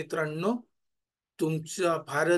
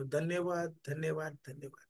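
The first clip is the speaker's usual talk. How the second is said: each धन्यवाद softer, dying away; softly fading thanks; 2 semitones lower, 10 dB quieter than usual. Acted. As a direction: let the thanks fade to almost nothing. Echoing thanks in meditation.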